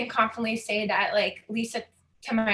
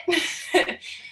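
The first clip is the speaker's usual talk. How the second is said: short unvoiced laugh, higher; breathy laugh through tears; 7 semitones higher than usual. Real, not acted. Recovering from tears with a breathy laugh. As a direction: laugh it off after nearly crying.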